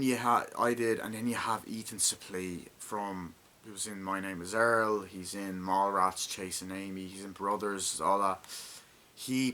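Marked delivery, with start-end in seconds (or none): none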